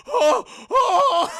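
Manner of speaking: loud, high shrieking gasps; hammed-up panicked "oh"; terrified gasps. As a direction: gasp in shock at a ghost. Acting out friend's terror.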